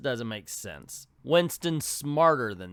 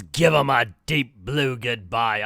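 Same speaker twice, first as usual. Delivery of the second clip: loud, slow Scottish yell; Captain Nemo; 2 semitones lower, 6 dB louder, slower than usual. Acted. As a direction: bellow it in a put-on Scottish accent. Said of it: "all of a sudden he's Scottish".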